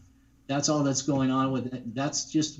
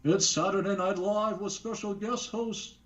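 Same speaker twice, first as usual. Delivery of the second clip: higher, booming announcer voice; Don Pardo impression; 6 semitones higher than usual. Guest announces the hosts as Don Pardo, grand and booming. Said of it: "I can do it in Don Pardo's voice".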